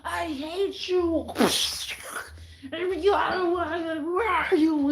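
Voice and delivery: high, slow, overwrought mock wail; hormonal woman. Mocking a woman on her period, high slow wail. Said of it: "they're just like".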